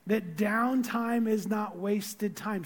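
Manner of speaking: downtime is not wasted time, each word set apart, slow; slow emphatic statement. Real, not acted. Pastor telling the congregation a key truth, slow and deliberate. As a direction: lay it down slowly, word by word. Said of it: "Can I, can I say this".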